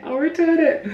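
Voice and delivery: I'll return it, with return squeaked very high then falling; I'll return it with a squeaky high fall. Retold or imitated. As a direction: drop return from a squeaky peak. Repeated line, falling take.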